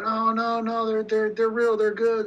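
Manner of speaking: high, quick protest that they're real; insistent pharmacist. Retold or imitated. Pharmacy clerk to wary customer, insistently high. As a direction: brush off the doubt, eager to sell.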